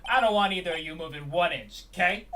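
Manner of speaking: loud Brooklyn cop giving orders; Brooklyn accent. Acted. Detective ordering two suspects to stay put, Brooklyn accent. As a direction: lay down the law in a Brooklyn accent. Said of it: "Let's do a Brooklyn accent".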